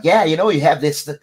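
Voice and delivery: high, loud imitation of thick Bostonian; exaggerated Boston-accent impression. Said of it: "his accent is so thick, Boston-, Bostonian thick".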